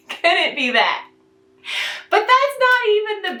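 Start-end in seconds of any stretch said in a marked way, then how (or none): 0.1-1.1 laughing sarcasm. Real, not acted.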